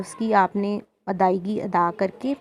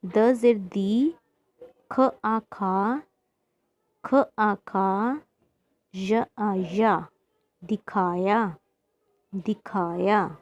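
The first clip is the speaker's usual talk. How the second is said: slow spelling-out; slower than usual. Shown as a demonstration. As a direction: spell each syllable very slowly, letter then vowel mark then sound. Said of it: "इसको पढ़ते हैं". (अक्षर-अक्षर जोड़कर बहुत धीरे पढ़ाना)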